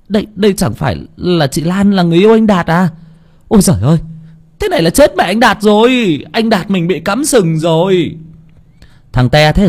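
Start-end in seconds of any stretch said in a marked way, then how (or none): 0.1-8.2 put-on high, startled child voice; high-pitched alarmed village kid; shocked boy. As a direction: gasp it out in disbelief. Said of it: "Thằng Tý trợn mắt".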